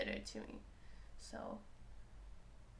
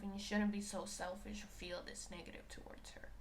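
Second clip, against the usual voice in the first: softer scolding whisper; whispered inner critic; 5 dB quieter than usual. Retold or imitated. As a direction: whisper the reproach. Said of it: "I'm like".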